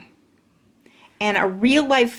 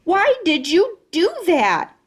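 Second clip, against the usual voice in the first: high, slow angry holler; 9 semitones higher, 3 dB louder than usual. Acted. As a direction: demand it furiously. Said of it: "she hollered".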